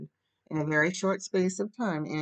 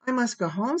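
higher, louder, dazed refusal; dogged insistence; 8 semitones higher, 5 dB louder than usual. A narrator's reading. Diana refusing all Anne's pleas, doggedly insistent. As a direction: insist on it stubbornly. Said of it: "and in vain Anne pleaded".

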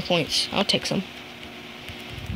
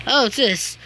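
usual speaking voice

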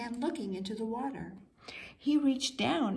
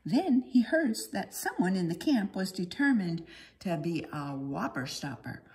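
plain reading voice

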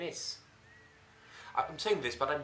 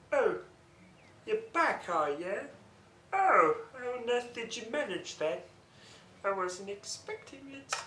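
mocking imitation; 9 semitones higher than usual. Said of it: "he just goes".

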